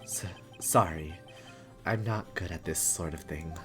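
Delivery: low, quiet, stammered; subdued and socially unsure; awkward apology. Acted. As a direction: apologise haltingly in a small, low voice.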